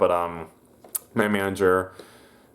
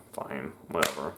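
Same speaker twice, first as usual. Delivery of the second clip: resigned sigh; 5 semitones lower, 9 dB quieter than usual. Retold or imitated. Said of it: "So I was like, sighs".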